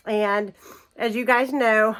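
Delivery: usual speaking voice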